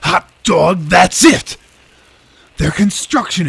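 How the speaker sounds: loud, high burst of triumph; excited. Acted. Detective to his rookie partner on the breakthrough, excited shout. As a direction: shout it like you cracked the case.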